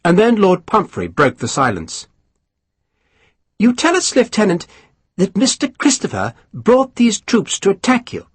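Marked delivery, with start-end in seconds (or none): 3.6-8.3 a higher, mannered gentleman's query; high aristocrat voice. Acted. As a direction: raise the pitch for the dainty lord. Pumphrey questions Sharp.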